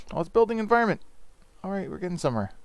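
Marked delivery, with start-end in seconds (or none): none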